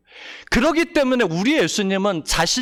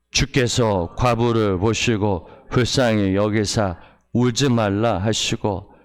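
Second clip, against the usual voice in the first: scripture reading; 7 semitones lower than usual. Acted. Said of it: "십삼 절 같이 읽겠습니다".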